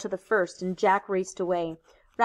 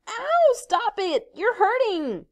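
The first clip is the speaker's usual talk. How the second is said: cry of pain; 13 semitones higher, slower than usual. Acted. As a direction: cry out, really hurt. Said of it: "then in real pain".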